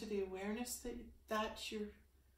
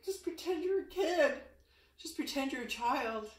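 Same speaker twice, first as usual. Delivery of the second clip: excited urging; 6 semitones higher, 8 dB louder than usual. Real, not acted. Urging viewers to play.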